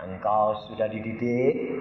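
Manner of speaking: low, smooth voice lifting at the end; imitated gentle Jesus. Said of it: "Ngomongnya halusnya kayak Tuhan Yesus".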